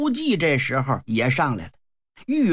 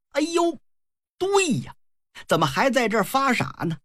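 high-pitched exclamation, then a quick self-reproach; sudden realisation; 7 semitones higher than usual. Acted. Leader realises the trick and scolds himself, sudden realisation.

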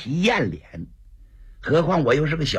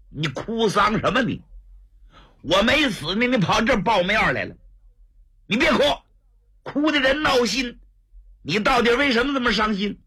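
irritated scolding; 6 semitones higher than usual. Acted. Brother scolds the crying visitor.

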